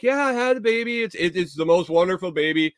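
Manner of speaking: mock proud-mom chatter; imitated boasting mother. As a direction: gush about the baby in a put-on high voice. Imitating mother boasting about baby.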